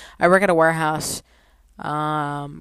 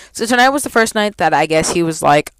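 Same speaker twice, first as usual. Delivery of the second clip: ordinary talk, nothing marked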